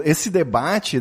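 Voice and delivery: ordinary talk, nothing marked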